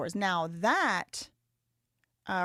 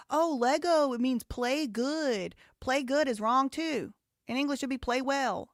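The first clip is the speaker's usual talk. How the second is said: higher, emphatic mock protest; exasperated mock quoting; mocking argument; 5 semitones higher, 4 dB louder than usual. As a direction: throw their argument back, pitched up. Mockingly voices the opposing argument.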